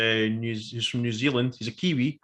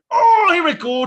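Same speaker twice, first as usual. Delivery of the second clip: high, loud burst of anticipation; excited fan cry; 10 dB louder than usual. Retold or imitated. Fan watching Qui-Gon fade away, re-enacted as an excited cry. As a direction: belt it out as a thrilled fan.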